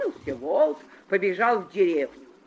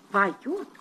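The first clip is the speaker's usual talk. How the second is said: plain reading voice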